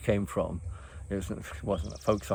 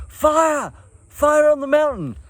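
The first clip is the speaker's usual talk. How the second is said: high, loud yelled warning; retold cry of fire, high and loud; alarmed shout; 9 dB louder, slower than usual. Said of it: "he's going".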